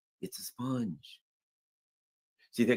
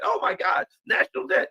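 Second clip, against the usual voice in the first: mock horror, pitched way up and climbing; shocked gasp. Trump hearing the debt, a high mock-shocked cry. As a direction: play Trump aghast at the debt figure.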